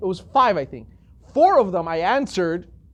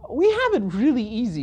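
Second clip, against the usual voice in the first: normal talking voice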